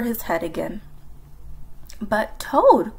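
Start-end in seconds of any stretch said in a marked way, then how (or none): 2.0-3.0 mildly raised protest; a louder, pleading cry. A narrator's reading. Frog protests as Toad returns to bed, crying out. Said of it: "cried Frog".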